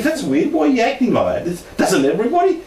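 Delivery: high-pitched, mildly baffled retelling; puzzled child. Retold or imitated. Child wondering at a friend's odd reaction, baffled.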